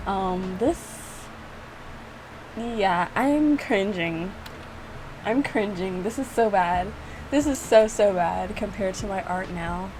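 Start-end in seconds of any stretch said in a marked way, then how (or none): none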